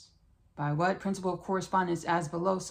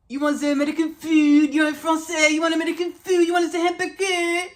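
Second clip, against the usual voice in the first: put-on high, loud French-accented outburst; mock French accent; 10 semitones higher, 7 dB louder than usual. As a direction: play an affronted Parisian in a hammed-up French accent. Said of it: "that's just the response I think they'll have".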